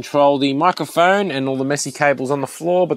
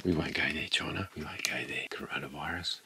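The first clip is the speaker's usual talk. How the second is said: whispering; 7 semitones lower, 17 dB quieter than usual. Pretend. Hushed aside about China.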